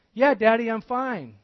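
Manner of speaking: put-on high, loud child's voice calling back; a touch of a little girl's reply. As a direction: answer like a young daughter hiding trouble.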